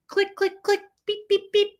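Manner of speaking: mock device sound effects; imitated machine clicks and beeps. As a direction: mimic a gadget clicking and beeping. Imitating chiropractor's device noises.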